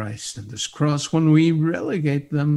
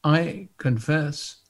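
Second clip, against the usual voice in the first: hymn recitation; 6 dB louder than usual. Acted.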